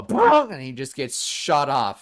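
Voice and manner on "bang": loud, high shouted bang. Retold or imitated. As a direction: burst out with a launch sound. Sound effect of launch.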